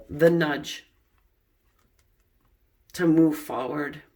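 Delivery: usual speaking voice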